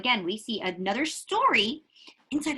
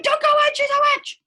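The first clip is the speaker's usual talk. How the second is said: high, loud urgent little chicken; eager chick blurting a warning; excited chicken warning; 8 dB louder, faster than usual. Acted. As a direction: warn them breathlessly high. Chicken warns Hansel and Gretel.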